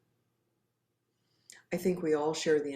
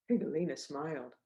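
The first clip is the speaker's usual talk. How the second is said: usual speaking voice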